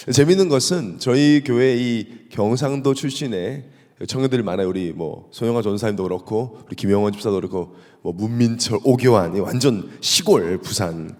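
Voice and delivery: normal talking voice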